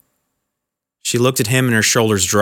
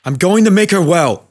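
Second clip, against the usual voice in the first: words wrenched out; 7 semitones higher, 3 dB louder than usual. Acted. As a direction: heave each word out in desperation. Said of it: "vomiting the words from the deepest part of him".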